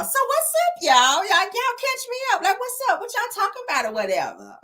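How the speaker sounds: high-pitched put-on 'what's up y'all'; high mimicked greeting of a reality star; mock greeting. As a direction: pitch it way up, then drop back down. Voicing Melody's greeting in recap.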